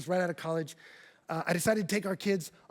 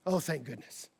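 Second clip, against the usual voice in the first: ordinary talk, nothing marked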